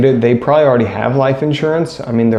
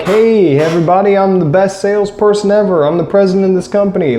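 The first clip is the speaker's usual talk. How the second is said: hyped-up company president; 6 semitones higher than usual. Retold or imitated. President jogging onstage to rally his salespeople, excited hype shout. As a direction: pump up the crowd like a stage showman. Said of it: "jogging out and jumping up and down like".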